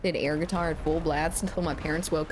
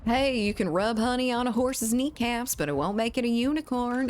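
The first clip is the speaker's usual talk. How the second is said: put-on Southern accent; 6 semitones higher than usual. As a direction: read the chat line like a Southern folk saying. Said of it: "I can only read that in a southern accent".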